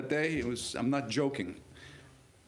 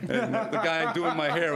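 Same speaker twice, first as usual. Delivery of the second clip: words broken by laughter, slow and louder; laughing; 5 semitones higher, 4 dB louder, slower than usual. Real, not acted. Telling the hair-and-makeup story while laughing out loud. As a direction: laugh through the line, real amusement.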